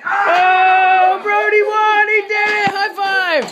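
high, loud victory shouts; excited cheering. Real, not acted. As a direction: celebrate the win loudly. Son wins air hockey, celebrating.

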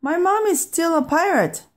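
high-pitched protest; calling out in worry. A narrator's reading. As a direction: call after someone in a high voice. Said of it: "Pete called".

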